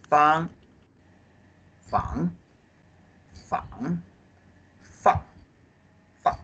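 fang said high, rising, dipping, falling; tone drill. Shown as a demonstration. Teacher drilling the tones of the phonetic part fang. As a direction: run fang through the four tones. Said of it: "This fang is re".

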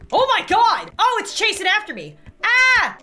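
loud, high panicked yelling. Real, not acted. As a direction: yell as something chases you. Being chased by monster.

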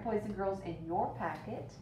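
plain reading voice

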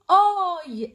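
اي held long like the English letter I; long letter-name sound. Shown as a demonstration. Teacher demonstrating the long sound of I, drawn out. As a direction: stretch اي into a long "eye". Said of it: "يعني كأن باسمه كده".

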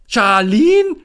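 high, very loud shocked cry; stunned loud outcry. Acted. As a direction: jump up crying out the name. Willi shocked hearing the name.

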